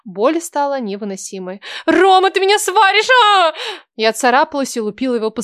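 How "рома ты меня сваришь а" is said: loud, high, frantic scream; struggling against restraints. Acted. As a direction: yell while fighting to break free. Героиня вырывается из рук. (отчаянный крик, вырываясь)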